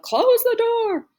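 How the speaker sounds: high, drawn-out family yell. A narrator's reading. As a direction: holler it loud and long. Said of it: "all yelled".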